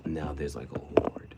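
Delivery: hushed whisper for effect. Acted. Whispering about the ant horde to viewers. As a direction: breathe it like a secret.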